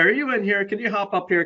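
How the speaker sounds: normal talking voice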